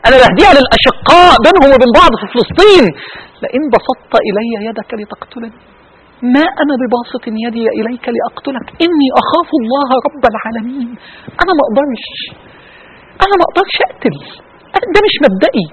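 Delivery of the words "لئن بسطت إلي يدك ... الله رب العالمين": hushed recitation. Acted. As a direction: recite the verse gently to the congregation. Preacher recites the Quran verse.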